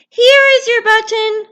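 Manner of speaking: eager Frog; hopeful Frog. Acted. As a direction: offer it hopefully.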